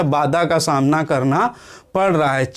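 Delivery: usual speaking voice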